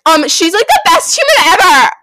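high, loud mock-offended outburst; angry outburst. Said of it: "getting so angry".

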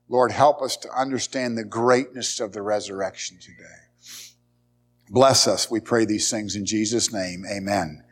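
bowed, steady prayer voice. Acted. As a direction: pray it reverently to the Lord. Closing prayer in sermon.

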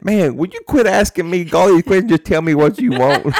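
hammed-up friend fed up with questions; imitating a fed-up buddy on the phone; exasperated friend. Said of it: "that ain't doing it justice like how he did it".

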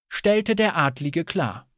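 ordinary delivery, nothing marked

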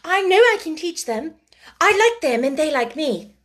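put-on high, loud, pleading child; eager exclamation. A narrator's reading. Orphan begs to teach the younger children, eagerly. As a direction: plead with excited hope. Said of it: "exclaimed Sarah".

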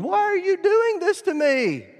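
high, loud wail of protest; hammed-up high doggy outcry; betrayed dog. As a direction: plead like a wronged little pet. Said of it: "little drowned rat looking up at you going".

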